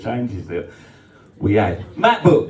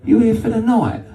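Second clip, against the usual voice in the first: lightly voiced woman; 9 semitones higher than usual. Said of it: "She went".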